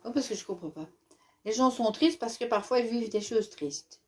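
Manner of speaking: usual speaking voice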